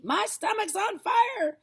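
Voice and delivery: very high, slow frantic cry; grandma wailing her stomach burns; panicked wail. A narrator's reading. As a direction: wail in alarm. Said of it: "she shouted suddenly".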